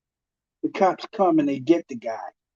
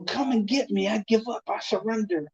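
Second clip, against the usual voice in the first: higher, pleading surrender to the cops; scared surrender; 6 semitones higher than usual. Retold or imitated. Man on the phone begging the cops: higher, scared pleading. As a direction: beg them to come take you in. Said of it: "he was like".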